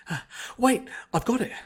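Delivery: light up with the idea; sudden realisation. A narrator's reading. Twilight hitting on a plan mid-fight, excited.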